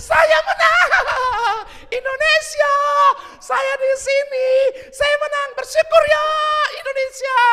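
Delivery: very high, loud tearful victory cry; teriakan kemenangan. Retold or imitated. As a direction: shout it out like an overjoyed champion. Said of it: "Apa itu ekspresinya?". (teriak menang sambil menangis, tinggi dan keras)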